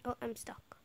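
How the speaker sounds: usual speaking voice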